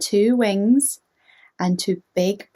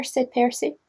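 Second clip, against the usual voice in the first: ordinary delivery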